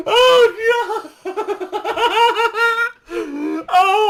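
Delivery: high, loud helpless laughter through the words; laughing hard. Real, not acted. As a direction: crack up while telling it. Laughing at the friend's fail.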